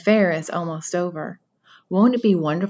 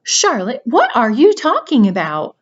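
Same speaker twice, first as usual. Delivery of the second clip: alarmed; 5 semitones higher, 7 dB louder than usual. Acted. Wilbur alarmed at dying friend.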